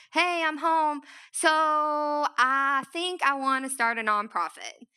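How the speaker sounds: high, bright-eyed big news, slowing on the second sentence; excited announcement. Retold or imitated. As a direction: burst in with the news, then draw it out.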